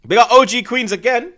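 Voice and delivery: high, loud hype call; loud shoutout. Real, not acted. Streamer shouting out a viewer, loud and high.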